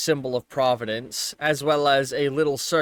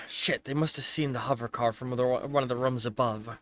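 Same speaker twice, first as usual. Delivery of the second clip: low, softer in-character remark; muttered in character; 3 semitones lower, 6 dB quieter than usual. Acted. Character realises the hover car was seen, muttering low. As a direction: keep it under your breath. Game master voices a guard or Jack.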